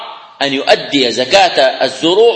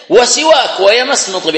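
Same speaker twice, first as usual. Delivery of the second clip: emphatic teaching; 7 semitones higher than usual. Real, not acted. Teacher insisting on hadith wording.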